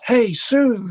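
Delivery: high, loud, friendly catcall; neighbour calling out. Retold or imitated. Neighbour trying to chat up Sue, calling out. Neighbour chatting her up in retold scene.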